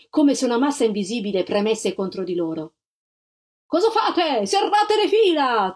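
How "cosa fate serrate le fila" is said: much higher, slow, booming command; thundering officers. A narrator's reading. Officers thunder at retreating troops to close ranks. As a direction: roar at the men to close ranks. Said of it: "tuonarono gli ufficiali".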